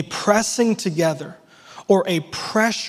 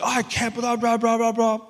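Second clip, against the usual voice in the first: whiny complaining; 4 semitones higher than usual. Retold or imitated. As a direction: grumble it half to yourself. Venting before phone call.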